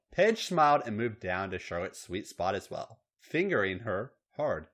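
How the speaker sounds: ordinary delivery, nothing marked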